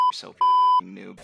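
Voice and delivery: loud, slow mocking yell; raging gamer yell. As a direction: drag out the insult loudly. Gamer rage continues.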